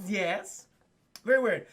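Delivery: ordinary delivery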